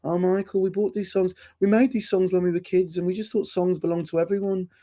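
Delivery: high-pitched, badly faked Scouse; comic Scouse impression, high and plaintive; terrible Scouse accent. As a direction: do Paul in a hammed-up Liverpool accent, pitched high. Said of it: "that's my terrible Scouse accent".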